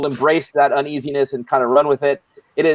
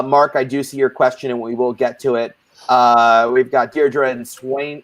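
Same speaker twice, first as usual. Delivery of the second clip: usual speaking voice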